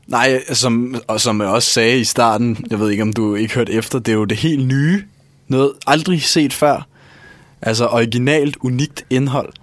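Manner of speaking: ordinary talk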